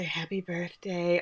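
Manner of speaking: soft, slow birthday wish, imitating the sisters; mock-intimate breathy murmur; breathy and weird. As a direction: say it softly and awkwardly, drawn out. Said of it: "all breathy and weird 'cause they're naked".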